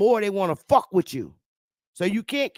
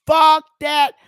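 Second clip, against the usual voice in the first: defiant outburst; 8 semitones higher, 8 dB louder than usual. Real, not acted. Defiant outburst about being submissive.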